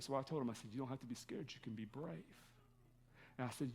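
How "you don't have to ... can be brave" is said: tender reassurance. Retold or imitated. Father comforting scared son in car.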